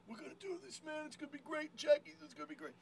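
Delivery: high, hushed pep talk; hyped-up kid. Retold or imitated. Kid psyching up Jackie before walking on, hyped-up.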